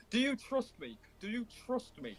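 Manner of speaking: hammed-up shout of do you trust me; mock shout. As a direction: gamer yelling at a teammate, mock version. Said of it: "shouting at Sleep".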